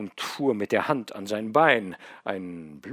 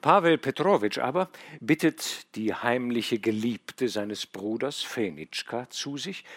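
plain reading voice

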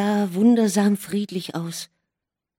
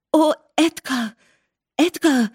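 high, breathless calling of his name; excited cry; 8 semitones higher, 4 dB louder than usual. A narrator's reading. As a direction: cry out in overflowing joy. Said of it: "rief sie".

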